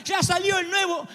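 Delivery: put-on high, slow, wailing shout of a spoiled kid; crying spoiled child. Preacher acts out a son crying to his dad, wailing shout. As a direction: wail it like a brat denied a phone. Child complaining about new iPhone.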